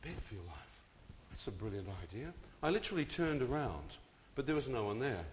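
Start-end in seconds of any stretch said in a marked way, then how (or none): 0.0-2.3 whispered thought. Retold or imitated. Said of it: "I thought".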